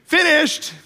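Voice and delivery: put-on high, bright kid's call down the hallway; a child calls out that he is done, high and loud; son yelling. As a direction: play the son shouting from afar. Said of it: "I hear my son yell down the hallway".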